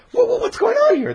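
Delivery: high, startled stammer; panicked confusion. Pretend. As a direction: sputter in alarm like frightened soldiers. Panicked Philistines.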